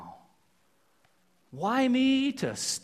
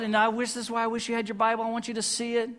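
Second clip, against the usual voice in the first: ordinary talk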